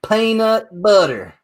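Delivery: teacher-style American accent, drawn out; American accent demo. Shown as a demonstration. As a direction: model American PEANUT BUTTER slowly for a learner. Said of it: "Repeat after me".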